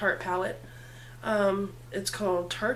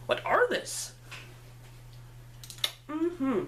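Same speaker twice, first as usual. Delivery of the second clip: silly voice; 4 semitones higher, 5 dB quieter, much slower than usual. Pretend. Playful silly question.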